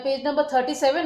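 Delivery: ordinary talk, nothing marked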